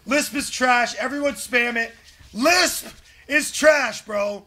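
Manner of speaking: yelling insults at a teammate; raging gamer. Real, not acted. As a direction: shout it in gamer rage.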